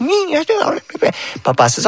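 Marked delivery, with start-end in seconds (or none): 0.0-0.3 much higher, grumpy squeak; mock a crabby auntie's grunt; crabby aunt. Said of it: "sometimes she's like".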